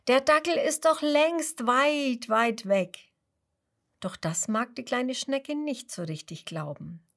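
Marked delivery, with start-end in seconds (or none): 0.1-3.1 reassuring butterfly. Acted. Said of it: "ruft Mister Schubert".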